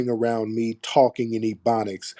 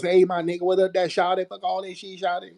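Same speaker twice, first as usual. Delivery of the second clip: mocking fake slang; 7 semitones higher than usual. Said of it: "we let these fools come around us".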